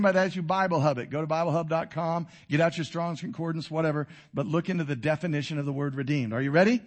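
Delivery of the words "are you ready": ordinary delivery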